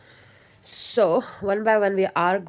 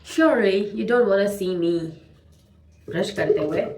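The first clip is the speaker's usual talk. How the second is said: ordinary delivery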